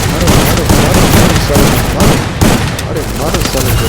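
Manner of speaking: frantic loud yelling; panicking gamer. Real, not acted. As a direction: shout urgently mid-firefight. Frantic firefight, urging teammates.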